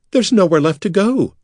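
high, anxious cry; desperate cry. Acted. Bekla surrounded by priests, high desperate cry. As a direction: cry out, cornered. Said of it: "Bekla cried".

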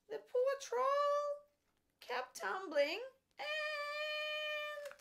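very high, slow tumbling voice; high squeaky narration. Acted. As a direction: squeal it as the troll falls. Troll falls off bridge.

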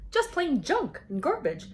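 plain reading voice

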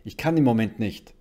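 husband shouting back; loud reply. A narrator's reading. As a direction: call back loudly from afar. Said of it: "gab ihr Mann lautstark zurück".

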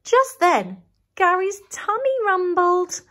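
plain reading voice

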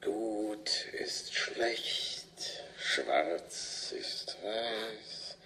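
slow, hushed incantation; slow eerie whisper. Acted. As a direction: intone it like a curse. Hanged man intones a riddle.

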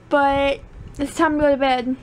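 normal talking voice

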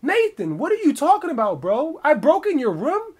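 loud, high-pitched indignant call; yelling out. Retold or imitated. Accused man to his roommate Nathan, high indignant yelling. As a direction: yell across at your accuser in disbelief. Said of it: "I yell out to him".

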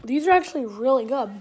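talking with mouth full; crunchy snack chewing; chewing while talking. Real, not acted. Seen 0: Chip bitten at -9s; closed-mouth jaw and cheek chewing motions follow.

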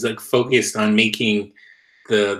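normal talking voice

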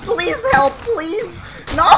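high-pitched, desperate plea; playful panicked begging; begging for life. Pretend. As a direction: beg the teammates for rescue. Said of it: "begging for his life".

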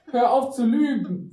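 high, loud tearful accusation; upset outburst. A narrator's reading. Emma accuses her date of lying, upset outburst. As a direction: cry out, on the edge of tears. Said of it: "Sie fing an zu weinen".

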